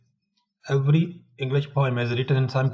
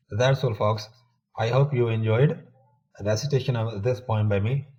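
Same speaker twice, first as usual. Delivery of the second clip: normal talking voice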